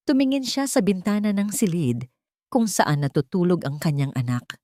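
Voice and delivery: plain reading voice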